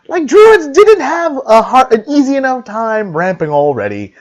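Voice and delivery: high, loud, laughing excitement; can't contain the glee; thrilled. Real, not acted. Card reveal, thrilled laughing.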